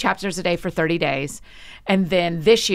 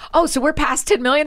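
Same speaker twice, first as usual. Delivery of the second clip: surprised; 9 semitones higher, 5 dB louder than usual. Retold or imitated. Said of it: "And I was like".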